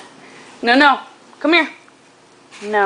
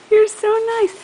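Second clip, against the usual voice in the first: much higher, cooing praise; 10 semitones higher, 3 dB louder, faster than usual. Real, not acted. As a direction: coo it up high. Gushing at baby.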